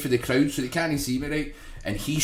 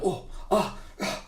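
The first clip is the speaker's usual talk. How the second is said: mock worried gasps; 6 semitones higher, 7 dB quieter than usual. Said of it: "I'm going".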